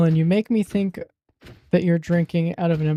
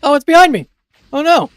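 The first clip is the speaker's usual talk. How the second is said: startled; 10 semitones higher, 11 dB louder than usual. Real, not acted. Startled by something in game.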